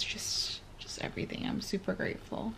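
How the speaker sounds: soft unvoiced whisper, nearly breathless; whispered gratitude. Real, not acted. Whispering thanks to her viewers, softly. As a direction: let it fall to a whisper. Emotional gratitude, voice drops away.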